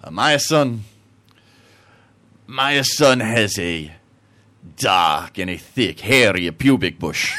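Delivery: slow, broken Italian-English; Italian accent. Acted. Italian guy describing his son, slow Italian accent. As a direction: draw it out as a joke Italian. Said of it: "the Italian guy is like".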